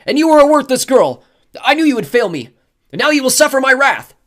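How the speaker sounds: enraged god's tirade; insistent roar. A narrator's reading. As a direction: shout high and very loud in fury. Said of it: "he insisted".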